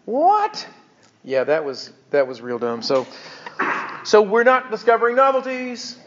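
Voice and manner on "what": high-pitched incredulous question; hammed-up astonishment; mock bafflement. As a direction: let it leap way up in disbelief. Mock bafflement at the absurd demand.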